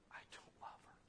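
whispered confession, barely voiced; a husband's secret, whispered to himself. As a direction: breathe it as a hidden truth. Husband's hidden inner truth.

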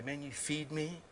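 lightly voiced grateful dog; quiet adoring dog; devoted dog voice. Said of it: "a dog will say".

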